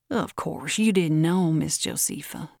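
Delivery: usual speaking voice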